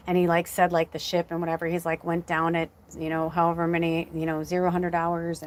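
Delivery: usual speaking voice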